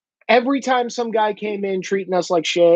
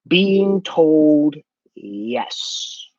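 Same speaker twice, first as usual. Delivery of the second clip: very slow, word by word; deliberate emphasis; 6 semitones lower, much slower than usual. Real, not acted. Host explaining these kids are used to it, drawn-out deliberate emphasis. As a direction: stretch out "yes" low and long. Spells out the root problem.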